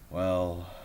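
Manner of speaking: ordinary delivery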